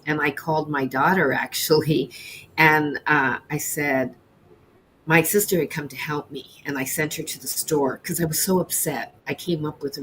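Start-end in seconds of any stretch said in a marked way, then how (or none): none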